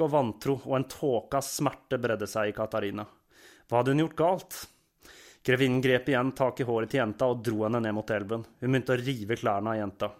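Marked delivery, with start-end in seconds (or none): none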